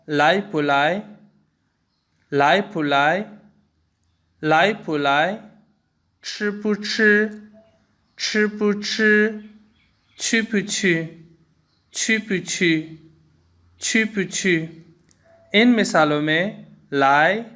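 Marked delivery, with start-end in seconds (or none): 0.1-5.4 लाई पुलाई with a rising lai, a light toneless bu, a rising lai; Mandarin lái bu lái. Shown as a demonstration. Teacher demonstrates neutral tone bu between verbs. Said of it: "मसलन". 6.2-14.5 slow Mandarin chi bu chi and qu bu qu with a light bu; Mandarin chī bu chī, qù bu qù. Shown as a demonstration. Teacher slowly models neutral tone bu for students. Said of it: "मसलन".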